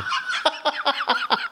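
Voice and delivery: high laugh bursting out; high squeal of laughter. Real, not acted. Co-host laughing before teasing Adnan, high laugh. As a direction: cracks up, high-pitched.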